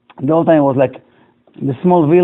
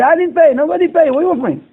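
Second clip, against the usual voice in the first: indignant yell; 9 semitones higher, faster than usual. Retold or imitated. Angry customer protests to the owner.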